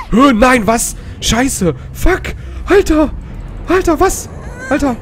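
very high, loud startled yelps; shocked exclamations. Real, not acted. Player suddenly surprised in the game, shocked yelping. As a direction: blurt out curses in shock.

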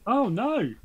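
sudden high, loud cry of dismay. Real, not acted. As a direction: blurt it out, alarmed the book is gone. Dismay at losing the book.

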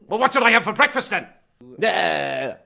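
loud, high, fast at first, a heated tirade; angry rant. Acted. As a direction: fire back, outraged at being known about. Interviewee rants at the interviewer.

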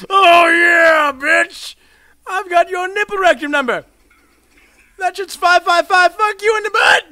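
high loud taunting yell; mock the victim at full volume; gloating shout. Real, not acted. Shouting taunts at the player he just killed.

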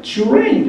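trainを「チュレイン」と高めに発音; 「チュレイン」の頭をチュで始める; チュに変わるT. Shown as a demonstration. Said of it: "earlの前はチュって言ってください". (英語式の「チュレイン」)

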